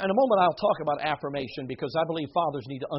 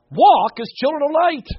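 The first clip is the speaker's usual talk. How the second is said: proclaimed verse; 11 semitones higher, 12 dB louder than usual. Acted. Quoting the next verse aloud.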